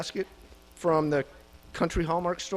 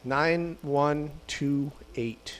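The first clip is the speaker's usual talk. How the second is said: slow number calling; 4 dB quieter, slower than usual. Real, not acted. Calling raffle number slowly.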